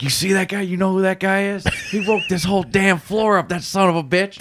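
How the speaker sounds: imitating an indignant guest, pitched well up; high-pitched impression of a worked-up stranger; irate hotel guest. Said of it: "There's some guy that was just".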